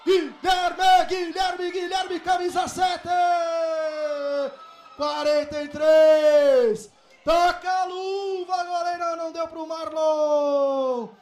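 long drawn-out golaço shout; explosive goal commentary. Real, not acted. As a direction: belt out the goal call. Free-kick goal.

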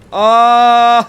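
high, loud "aah"; long, loud cry of dismay; frustrated scream. Real, not acted. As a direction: scream as you fall. Falls in the game.

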